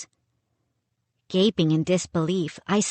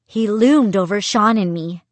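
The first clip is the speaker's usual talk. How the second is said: ordinary delivery, nothing marked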